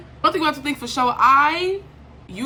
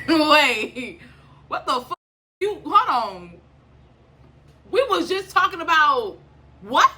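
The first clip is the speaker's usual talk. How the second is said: higher, louder, thrown off; shocked disbelief; 6 semitones higher, 4 dB louder, slower than usual. Retold or imitated. To the podcaster after his topic switch, a higher, louder shocked disbelief. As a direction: caught off guard, demanding an answer.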